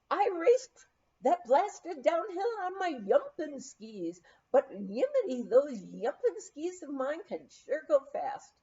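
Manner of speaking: Scandinavian lilt, with y for j; a jolly Norwegian skier, yumping and yimmity; Norwegian accent. Acted. Big Viking skier bragging to the lodge crowd, in a Norwegian accent.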